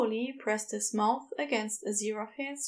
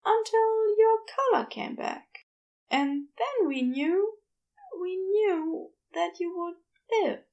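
higher, gentle Aziraphale explaining; higher Aziraphale telling Crowley what happened; 8 semitones higher, 4 dB louder than usual. A narrator's reading. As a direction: raise the pitch and soften the bad news. Aziraphale recounts.

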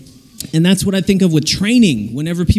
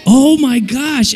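normal talking voice

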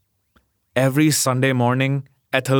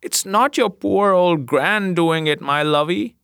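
put-on higher, tender grandmother comforting a sick boy; 6 semitones higher than usual. A narrator's reading. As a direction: soothe the sick grandchild gently. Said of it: "said old Ma Parker".

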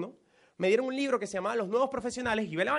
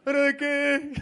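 mock impatient friend; 4 semitones higher, much slower than usual. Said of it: "la gente cuando uno lo invita por teléfono dice esto".

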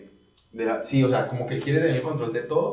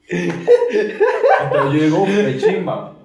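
higher and louder through laughter; laughing; 5 semitones higher, 7 dB louder, much slower than usual. Real, not acted. Telling friends about his date, laughing higher and louder. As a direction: laugh out the words.

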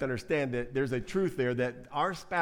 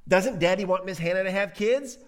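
put-on higher, louder kid's question; little child; 5 semitones higher, 6 dB louder than usual. Little one asking about Daddy and Hannah, child voice. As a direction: ask like a curious little child. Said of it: "says the little one".

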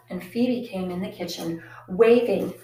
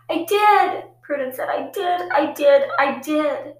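insistent cry; 8 semitones higher, 8 dB louder than usual. Acted. Prudence insisting she blew it.

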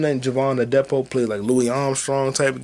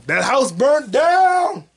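slow, high, loud shock; shocked exclamation; 13 semitones higher, 5 dB louder, much slower than usual. Real, not acted. Watching a movie, the house burns, shocked. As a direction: react like you just saw it burn. Reacting to film playing.

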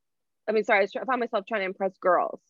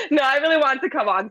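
laughing; 6 semitones higher, 6 dB louder than usual. Real, not acted. Laughing, emotional thanks.

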